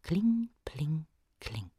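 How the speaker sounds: hushed, fading clinks; quiet echo of an anvil; soft distant chime. A narrator's reading. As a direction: let it ring faintly from far away. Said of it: "in der Ferne ein leises".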